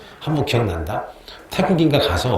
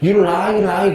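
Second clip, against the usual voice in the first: Thai English; 6 semitones higher, much slower than usual. Retold or imitated. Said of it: "길거리에서".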